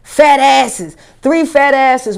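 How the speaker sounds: ordinary talk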